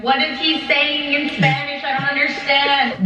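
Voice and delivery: high-pitched mock panic; imitated freaking out. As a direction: voice Larry in a high fluster. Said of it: "Freaking out. Larry's being like".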